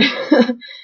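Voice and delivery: a quick burst of laughter; short laugh. Real, not acted. Laughs at own drooling.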